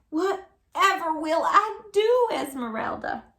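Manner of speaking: high, loud, slow wail of despair; distressed cry. A narrator's reading. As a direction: wail it like an old woman at her wits' end. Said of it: "she cried".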